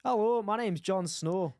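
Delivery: put-on Scottish accent, drawn out. Shown as a demonstration. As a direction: do a slow mock Scottish accent. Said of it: "in a Scottish accent".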